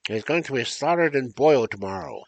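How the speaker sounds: loud, high, bossy witch. Acted. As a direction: bark orders like a witch. Witch orders Gretel, loud.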